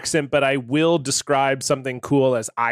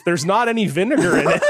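laughing, faster, higher; laughing through a quote; 9 semitones higher, faster than usual. Retold or imitated. Co-host retelling a newscaster's line, laughing. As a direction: quote her while cracking up. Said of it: "and then she says".

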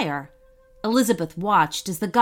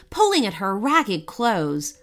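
plain reading voice

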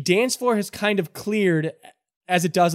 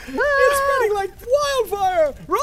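very high frantic squeal; panicked cry. Acted. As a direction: scream it like the crowd is fleeing. GM voices panicked crowd.

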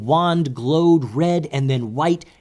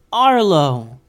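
higher, louder call to a friend; excited yell; 5 semitones higher, 7 dB louder than usual. A narrator's reading. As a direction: call out the name happily. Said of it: "yelled Goose".

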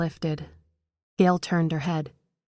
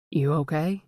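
words muffled as if through a scarf. A narrator's reading. Stranger checking on fallen woman, muffled. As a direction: speak as if through a thick parka. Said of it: "His voice was muffled".

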